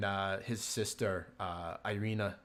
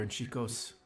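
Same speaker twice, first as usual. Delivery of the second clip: normal talking voice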